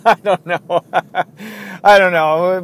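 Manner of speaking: high, loud, laughing admission. Real, not acted. Host stumped by his own silly acronym, laughing. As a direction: laugh through the words, giving up.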